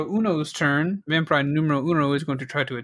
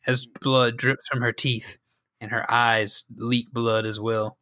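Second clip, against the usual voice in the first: ordinary talk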